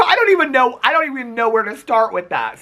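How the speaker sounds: incredulous high-pitched reply; exasperated disbelief. Retold or imitated. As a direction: throw up your hands at the request. Baffled reply to boyfriend's request.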